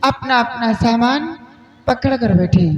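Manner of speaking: falling announcer cadence; station announcement. Acted. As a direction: read it like a platform announcement.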